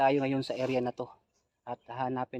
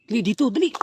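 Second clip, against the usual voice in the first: urgent call; 10 semitones higher, 9 dB louder, faster than usual. Real, not acted. Rushing companion along.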